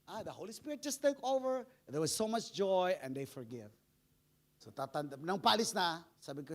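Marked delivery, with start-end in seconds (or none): none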